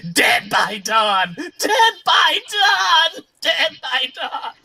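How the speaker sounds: high, drawn-out monster shriek; gleeful ghoul. Acted. Dying ghoul taunting the one who felled it, shrill gleeful chant. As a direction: screech it slowly like a cackling ghoul. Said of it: "still laughing, and it says".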